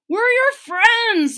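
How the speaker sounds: high, loud and slow, all together; eager chorus. A narrator's reading. The bunny friends cheer up sick Wilbur, high and loud.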